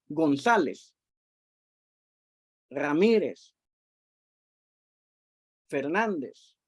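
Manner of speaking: "González, Ramírez, Fernández", each stressed on the second-to-last syllable; spaced-out names "González, Ramírez, Fernández", weight on ZÁ, MÍ, NÁN; penultimate stress. Shown as a demonstration. Teacher giving examples of llanas, penultimate stress. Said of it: "van en la penúltima".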